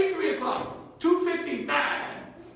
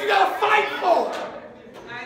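preaching shout; 5 semitones higher than usual. Real, not acted. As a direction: cry it out to the church. Preaching at full force.

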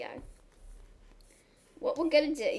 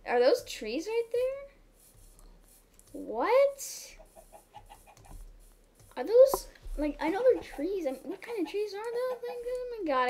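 higher, incredulous questioning; amazed; 9 semitones higher than usual. Real, not acted. Puzzling over strange trees, high amazed. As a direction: gape at the weird trees.

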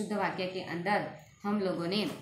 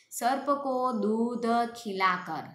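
slow, higher read of the wrong sentence; slow, pitched-up dictation; slow wrong example; 4 semitones higher, slower than usual. Shown as a demonstration. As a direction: dictate the mistake slowly. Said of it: "दूध खिलाया जाता है क्या?".

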